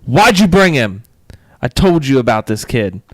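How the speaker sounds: loud, scolding mother-in-law impression; hammed-up angry mother; hostile mother-in-law. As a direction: snap at an unwelcome guest. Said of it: "And she goes".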